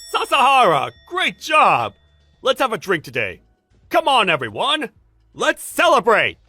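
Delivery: loud, high celebratory cheer; rally the whole office to party; jubilant. Acted. Boss congratulating employee on contract, jubilant shout.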